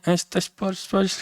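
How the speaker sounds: hurried local answer; dismissive local. Retold or imitated. Florianópolis local brushing off the confused newcomer. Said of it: "Eu falo".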